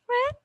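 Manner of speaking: "Friend" very high and questioning; rising intonation. Shown as a demonstration. Teacher isolates the question's last word, rising intonation. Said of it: "we have the rising intonation".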